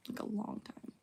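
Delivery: voiceless whisper trailing off. Real, not acted. Whispering to viewers how long she has been medicated. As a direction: let it fall into a whisper.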